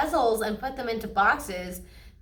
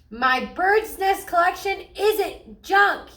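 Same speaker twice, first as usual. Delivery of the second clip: much higher, louder slow yell; 9 semitones higher, 6 dB louder, slower than usual. A narrator's reading. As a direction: yell it at the top of your lungs. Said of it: "yelled brother at the top of his lungs".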